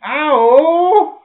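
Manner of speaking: sudden loud, high cry of pain; pained yelp. Pretend. Preacher voicing a hurt finger, sudden loud high yelp.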